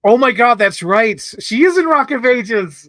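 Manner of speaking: loud, high burst of recognition; real excitement. Real, not acted. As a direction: exclaim it as the memory hits. Suddenly remembers actress's film.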